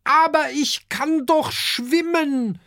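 high, slow little dragon protest; Oskar replying. A narrator's reading. Oskar tells his worried parents he can swim, high and pleading. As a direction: plead innocently from the water. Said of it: "erwidert Oskar".

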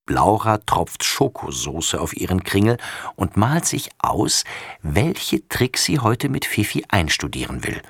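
plain reading voice

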